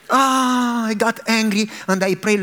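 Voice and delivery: ordinary talk, nothing marked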